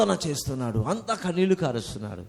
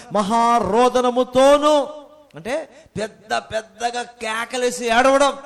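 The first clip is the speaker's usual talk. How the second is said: louder and slow, half-chanted; drawn-out emphasis; 5 semitones higher, 6 dB louder, much slower than usual. Shown as a demonstration. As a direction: stretch మహారోదనముతోను out, loud and slow. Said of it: "మహారోదనముతో అంటే ఏంటి?". (మహారోదనముతోను అని గట్టిగా, నెమ్మదిగా, పాడినట్టు)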